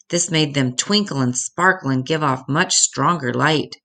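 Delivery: usual speaking voice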